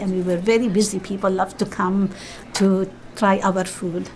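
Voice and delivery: ordinary talk, nothing marked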